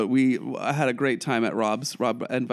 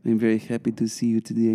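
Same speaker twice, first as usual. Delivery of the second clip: attempted Russian accent, lower; 4 semitones lower than usual. Shown as a demonstration. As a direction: try on a Russian accent for her. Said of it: "do your accent again".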